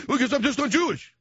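high, loud whiny-child imitation; mock childish protest; defensive kid. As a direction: insist like a cornered kid. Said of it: "what are you defensive about it for".

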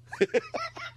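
high squealing laugh. Real, not acted. Host laughing at his own story, high squeal.

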